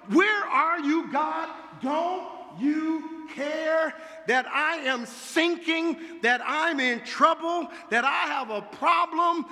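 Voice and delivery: a sinking soul calling out; desperate cry. Acted. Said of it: "the cry of so many people".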